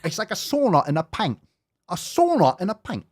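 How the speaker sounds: high Aussie parroting; mocking Aussie echo; mock Australian accent. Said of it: "like, Australian influencer".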